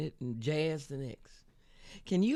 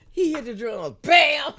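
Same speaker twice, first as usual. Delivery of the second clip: louder bam, laughing as she says it; laughing drum bam; 7 semitones higher, 13 dB louder than usual. Retold or imitated. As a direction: burst out with the drum hit. Drummer's crash, told with laughter.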